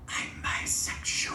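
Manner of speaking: hushed, breathy secret; whispered confession. Retold or imitated. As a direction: whisper it like a drunk confiding. Drunk friend's whispered secret.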